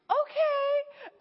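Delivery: high, strained little okay through a laugh; nervous okay. Retold or imitated. New girl facing a ward alone, high nervous okay. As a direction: force out a brave okay. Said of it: "I'm just like".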